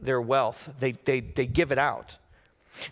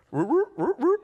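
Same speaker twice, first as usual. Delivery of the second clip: high, rising puzzled yelps; comic double-take noises, pitched up in bewilderment; baffled cartoon noise; 14 semitones higher than usual. Son thrown by dad's reproach, comically baffled noises.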